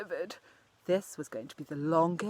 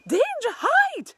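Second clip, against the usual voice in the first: much higher, louder, slow bird alarm call; alarm squawk; 8 dB louder, slower than usual. Acted. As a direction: scream a warning to the woods.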